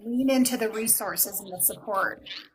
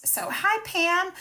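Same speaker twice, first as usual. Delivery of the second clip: bright high hello to a viewer; excited greeting; 11 semitones higher, 4 dB louder, slower than usual. Real, not acted. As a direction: call out a cheerful hi.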